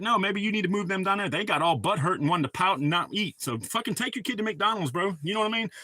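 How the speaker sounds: ordinary talk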